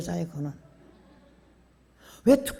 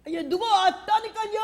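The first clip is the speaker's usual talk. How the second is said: wife shouting that someone is at the door; 11 semitones higher than usual. Retold or imitated. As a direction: shout across the house, exasperated. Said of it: "세 번째 내가 소리 질렀더니".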